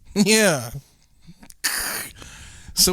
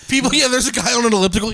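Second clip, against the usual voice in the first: ordinary delivery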